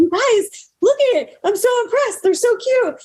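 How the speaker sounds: high, gushing and thrilled; delighted squeal. Retold or imitated. To her friends after surgery, high delighted gushing. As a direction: squeal with pride at the result.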